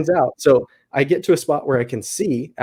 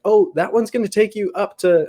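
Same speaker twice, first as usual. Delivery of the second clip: lightly mimed call up the trail, raised pitch; a mild mock yell to someone far off; calling back; 9 semitones higher than usual. Hiker calling to the lost lady, mild mock yell. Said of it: "I yell back to her".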